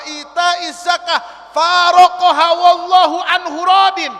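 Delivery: hadith recitation. Real, not acted. Preacher reciting a hadith to listeners, building to a shout. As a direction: rise to a ringing declaration.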